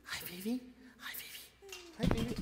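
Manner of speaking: soft, high doggy-greeting voice; coaxing pet talk. Real, not acted. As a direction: coo quietly to your dog to say hi. Coaxing his dog to say hi.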